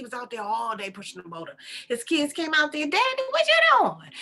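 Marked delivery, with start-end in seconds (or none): 2.9-4.1 high, loud mimicked kid voice; piercing childlike question; calling child. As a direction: holler to Daddy like a little kid. His kids call to him.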